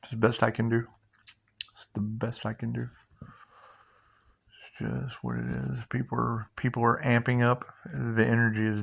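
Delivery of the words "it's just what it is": slow, deep intonation. Acted. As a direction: lower and softer, dragged very slowly. Mystic catchphrase, drawn out.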